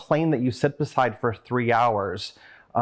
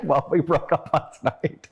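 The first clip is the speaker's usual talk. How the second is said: laughing through it; 4 semitones higher than usual. Real, not acted. Telling friend about breakup.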